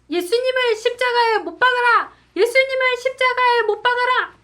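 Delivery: high, loud shout of an angry mob; crowd shouting. Acted. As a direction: cry out like a crowd demanding it. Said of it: "사람들은 이렇게 외쳤어요". (군중처럼 높고 크게 외치기)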